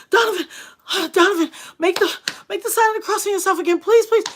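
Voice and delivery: high, loud, fast panicked pleading; frantic pleading. Retold or imitated. Mother begging her son on the phone, panicked and loud. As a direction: beg him in a panic to cross himself.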